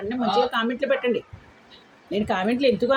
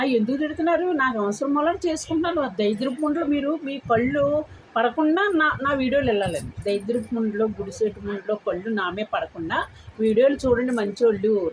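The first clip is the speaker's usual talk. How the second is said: ordinary talk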